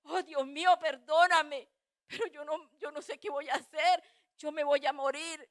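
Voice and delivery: re-enacted anguished plea; humbled pleading prayer. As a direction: beg God at full voice, desperately. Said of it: "me humillé y le dije".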